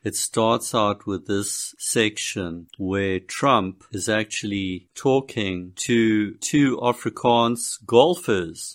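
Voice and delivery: usual speaking voice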